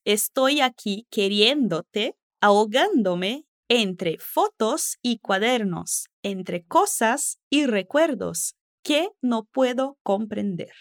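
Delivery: slow, clear reading of lyrics. Shown as a demonstration. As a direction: spell out each word slowly. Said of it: "falando lentamente o que ela diz".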